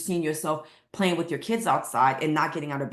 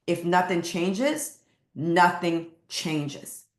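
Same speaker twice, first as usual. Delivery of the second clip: slow, weighted "If nothing changes, nothing changes"; slow emphatic repeat; slower than usual. Shown as a demonstration. Coach repeats motto to viewers, slow and weighty. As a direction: lean on every word, slowly. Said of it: "Let me say it again".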